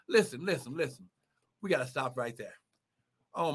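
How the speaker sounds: ordinary talk, nothing marked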